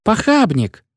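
high, thin voice from the back; a thin heckle from the back row; high thin heckler. A narrator's reading. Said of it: "сказал тонкий голос".

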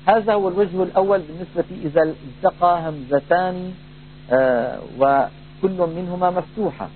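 ordinary talk, nothing marked

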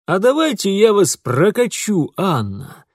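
bold, high, loud cry; dashing exclamation. A narrator's reading. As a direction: shout it out with swagger. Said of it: "Вдруг залихватски вскричал поручик".

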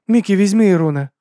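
high, loud order; urgent woman commanding. A narrator's reading. Abena orders the bot.

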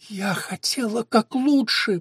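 high, slow, drawn-out moan. A narrator's reading. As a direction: moan it out slowly. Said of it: "простонала она".

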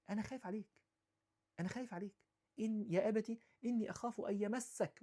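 soft and gentle, a son fearing for his father; tender plea. Retold or imitated. Son telling his father gently that he fears for him, soft and tender. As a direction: say it softly, with love. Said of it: "قولها بطريقة حلوة،".

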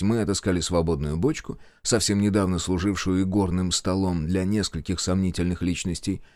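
ordinary delivery, nothing marked

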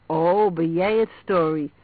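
loud, drawn-out letdown; disappointed recognition, slow and loud. A narrator's reading. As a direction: let the disappointment out on a long 'oh'. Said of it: "op teleurgestelde toon zei".

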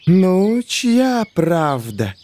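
slow, gloating snake hiss. A narrator's reading. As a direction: drag the question out smugly. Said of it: "снова прошипела змея".

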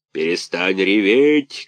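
slow, heavy puppet-master bark; gruff slow shout. A narrator's reading. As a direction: Karabas snaps at the whining puppet, gruff shout. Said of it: "крикнул Карабас-Барабас".

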